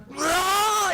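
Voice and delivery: sudden high yell, pushing himself; retold battle yell. Actor in the mask, shouting to gee himself up. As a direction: let out a high fighting roar. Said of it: "I remember just going".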